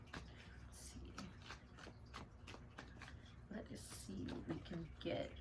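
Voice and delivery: whispered aside to herself; whispering. Real, not acted. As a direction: murmur it under your breath while choosing. Drawing a card, talking to herself.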